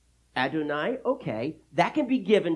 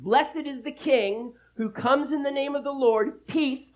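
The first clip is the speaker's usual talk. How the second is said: raised, high-pitched proclamation; joyful praise; 9 semitones higher, 4 dB louder than usual. A narrator's reading. Disciples praising their coming King, joyfully. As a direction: ring it out as crowd praise. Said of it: "joyfully to praise God in loud voices".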